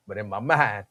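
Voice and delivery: normal talking voice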